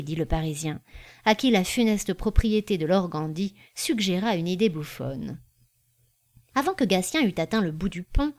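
plain reading voice

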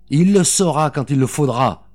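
loud, high, defiant answer; defiant retort. A narrator's reading. Captor to the armed mother, defiant retort. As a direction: throw the order back at her.

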